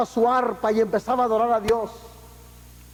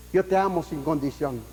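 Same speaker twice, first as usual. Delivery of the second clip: god speaking; 6 semitones lower, 3 dB quieter than usual. Acted. God's voice in sermon.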